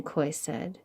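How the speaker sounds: usual speaking voice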